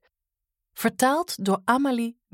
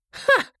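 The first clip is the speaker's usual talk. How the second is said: loud, high scornful "Ha!"; scornful laugh; 5 dB louder than usual. Acted. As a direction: bark a mocking laugh. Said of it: "lachte Grim".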